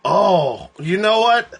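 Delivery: normal talking voice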